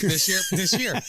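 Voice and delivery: mock his own slowness; mocking himself. Host poking fun at himself, mock repeat. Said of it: "See how I made fun of myself".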